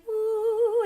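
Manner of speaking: a high, delighted woo as it all clicks back; excited woo. Retold or imitated. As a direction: let the relief pop out high. The sound suddenly works again.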